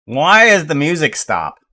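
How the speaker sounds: loud, high mock complaint; mock demanding. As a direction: call the music player to account, loudly. Calling out stopped music player.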